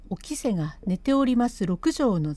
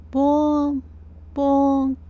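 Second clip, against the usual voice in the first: imitated bell toll; 5 semitones higher, 4 dB louder than usual. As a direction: ring it out like a distant temple bell. Temple bell tolling.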